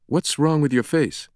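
high, pointed question; blunt demand. A narrator's reading. Sienna questions the stranger about his rash, demanding. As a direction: confront him bluntly. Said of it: "Sienna demanded".

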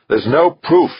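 loud, high-pitched insistence; coldly furious. A narrator's reading. Westfall denying there is proof, coldly furious. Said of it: "her voice coldly furious".

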